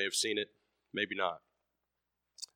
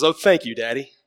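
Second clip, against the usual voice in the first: put-on louder, higher, delighted little boy; excited thanks; 4 semitones higher, 11 dB louder than usual. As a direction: burst out with a child's happy thanks.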